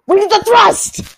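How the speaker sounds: high-pitched hammed-up exclamation; high, punchy character voice; Nichols. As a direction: cry it out high, as a comic character impression. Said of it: "that was my Nichols impression".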